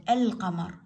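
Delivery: القمر with a clearly sounded L; sounded lam. Shown as a demonstration. Teacher shows pupils a moon letter, lam sounded. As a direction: say القمر as "al-qamar". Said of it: "باعوا اللام اللفظة".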